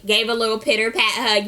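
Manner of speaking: ordinary talk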